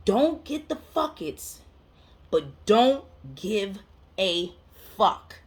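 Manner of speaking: very slow, each word set apart; deliberate, heavily spaced emphasis; emphatic repetition. Real, not acted. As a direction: drive the motto home word by word. Repeats main point for emphasis.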